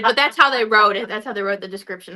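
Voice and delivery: ordinary talk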